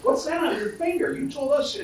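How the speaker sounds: high-pitched incredulous retelling; mock astonishment. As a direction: play the startled colleague calling her out, voice way up. Acting startled colleague questioning engaged woman.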